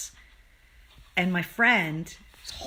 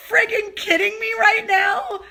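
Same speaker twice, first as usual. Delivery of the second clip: high, loud, incredulous shout half laughing; exasperated shout; 12 semitones higher, 7 dB louder than usual. Retold or imitated. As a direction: yell it in disbelief, almost breaking. Retelling exasperated reaction to friend.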